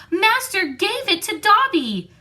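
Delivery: high, squeaky house-elf, awed; a tiny elf marvelling at his gift; elf in wonderment. A narrator's reading. Dobby the house-elf, holding up the sock, awed and squeaky.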